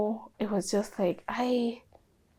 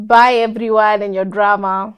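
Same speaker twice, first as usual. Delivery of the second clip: loud farewell; 8 dB louder than usual. Retold or imitated. Imagined farewell to office.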